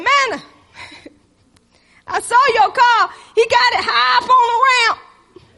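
high, loud, laughing mockery; uncle laughing at her car. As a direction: tease her through a laugh. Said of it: "Uncle Butch called me and said".

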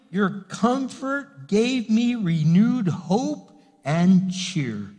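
unhurried, reverent reading of the verse; slow scripture reading. Acted. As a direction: read the psalm slowly, lingering on each phrase. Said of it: "it says".

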